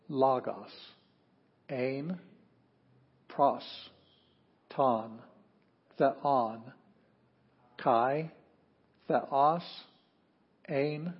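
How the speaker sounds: slow choral reading of Greek, one word at a time; slow recitation, word by word. Shown as a demonstration. Teacher leading the class through John, slow recitation. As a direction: pause after every word so the class can repeat. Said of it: "let's say it together".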